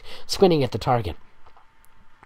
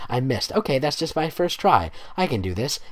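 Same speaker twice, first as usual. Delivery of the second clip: ordinary delivery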